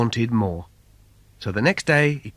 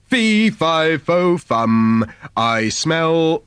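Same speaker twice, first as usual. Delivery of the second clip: loud booming giant chant, cut short; giant roaring; 4 dB louder, much slower than usual. Acted. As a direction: roar it out like a menacing giant. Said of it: "the giant roared out".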